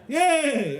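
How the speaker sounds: high, loud yay; cheer. Real, not acted. Host hyping next comedian, loud cheer. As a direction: cheer her on.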